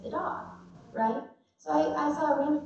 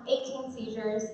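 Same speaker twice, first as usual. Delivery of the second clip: usual speaking voice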